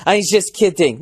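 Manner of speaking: high, simpering mock cabbie; mock passive-aggressive. Comic mimicking cabbie's false retreat, mock passive-aggressive. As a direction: imitate him with a wimpy little smile. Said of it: "he does this little pussy smile".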